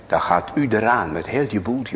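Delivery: ordinary talk, nothing marked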